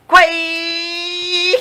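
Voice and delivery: high, far-off cutesy kawaii; mock cutesy squeal. Real, not acted. Friend mocking the story's kawaii, in a squeal. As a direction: squeal kawaii like an anime fan.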